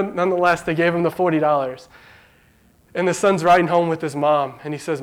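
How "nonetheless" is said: ordinary talk, nothing marked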